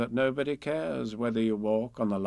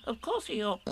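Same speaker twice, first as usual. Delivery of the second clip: high little piping reply; Piglet; 10 semitones higher, 4 dB quieter than usual. A narrator's reading. Piglet confirms to Eeyore, high and small. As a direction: answer quickly in a tiny voice.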